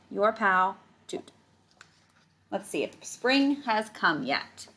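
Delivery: usual speaking voice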